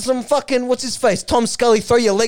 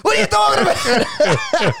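drawn-out mock shout; 9 semitones higher than usual. Footballer repeating his retort to the interviewer, slow stretched shout. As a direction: drag out "What are you talking about?" in disbelief. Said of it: "It's like".

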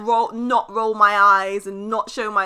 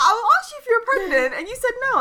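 very high, louder mock student voice; protesting pupil; 13 semitones higher, 5 dB louder than usual. Student confronts teacher who lied, exaggerated high protest.

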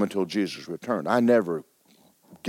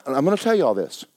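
usual speaking voice

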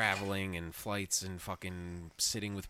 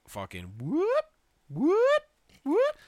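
high rising siren whoops; loud siren imitation whoop; alarm siren whoops; 7 dB louder, much slower than usual. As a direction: mimic a fire alarm going off.

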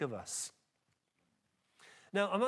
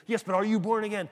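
louder, higher pestering repeat; insistent; 4 semitones higher, 6 dB louder, faster than usual. Retold or imitated. Street preacher presses a fellow believer, insistent. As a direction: imitate a zealot pressing the question.

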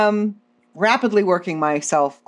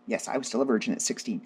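ordinary delivery

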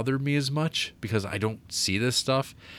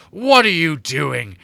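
slow, loud, hammed-up dramatic demand; Charles Dance; 5 semitones higher, 8 dB louder, slower than usual. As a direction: make a grand, raised-pitch entrance. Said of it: "Charles Dance shows up".

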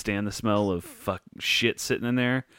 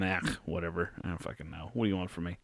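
dog's grumpy inner voice; 4 semitones lower, 4 dB quieter, much faster than usual. Retold or imitated. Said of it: "The dog just going".

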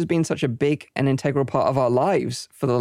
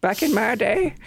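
high-pitched mock old-timer; rocking-chair granddad. Future granddad reminiscing from a rocking chair, mock old-timer voice. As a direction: put on a high, hammed-up granddad voice. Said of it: "We'll be sitting in our rocking chairs".

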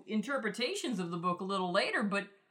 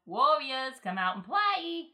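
loud sing-song taunt, higher and louder; Warriors taunt; 6 semitones higher, 6 dB louder than usual. Retold or imitated. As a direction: call them out to play, teasing. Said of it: "Not that voice".

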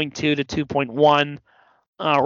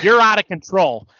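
raised, louder mock scolding; mock accusation, pitched up a touch; imitated wrestling promo; 3 semitones higher, 5 dB louder than usual. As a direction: imitate a ringside interviewer calling someone out. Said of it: "and he's like".

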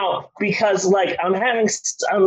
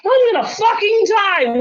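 panicked outburst. Retold or imitated. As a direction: blurt it in mock mortal panic. Retells panicking while high.

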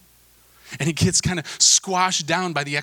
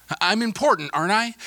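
higher-pitched, needy plea for approval; seeking validation; 7 semitones higher than usual. Acted. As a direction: ask it high, fishing for reassurance. Acting out people seeking others' approval.

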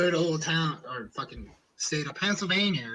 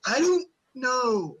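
incredulous; 9 semitones higher, 4 dB louder, slower than usual. Retold or imitated. Retold reply to crude remark.

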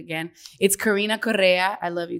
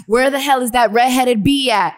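loud, higher mock-drunk demand; Karina Correa; 4 semitones higher, 8 dB louder than usual. Imitating drunk friend hunting for Sarah, loud and indignant. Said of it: "every time I would imitate her".

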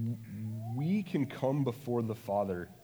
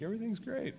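high, sing-song forced-cheerful voice; mock chirpy denial; fake cheerfulness; 11 semitones higher, 5 dB quieter, faster than usual. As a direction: pretend everything is fine. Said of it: "dance and be like".

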